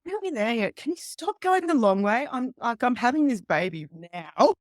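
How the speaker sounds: mock desperate plea from the back seat; urgent complaint in labour. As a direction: hurry him up, you're about to give birth. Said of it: "sticking my head up going".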